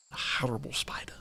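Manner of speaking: eerie hushed whisper; whispered creepy aside. Acted. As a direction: breathe it like a secret. Whispers about the spider.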